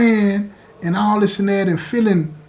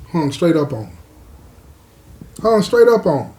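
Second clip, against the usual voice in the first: ordinary talk, nothing marked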